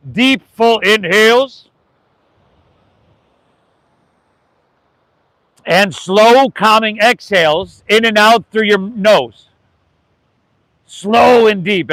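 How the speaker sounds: slow, calm yoga voice; teacher setting the breathing pace for the class; slow breath cue. Acted. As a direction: draw each word out gently.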